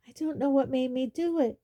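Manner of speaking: frantic gasping regret; gasping panic. Acted. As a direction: gasp it out in horror. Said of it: "Ron gasped again".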